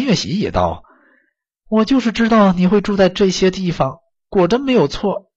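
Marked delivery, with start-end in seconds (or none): 1.7-5.3 mother's voice. A narrator's reading. Mother answers son in story.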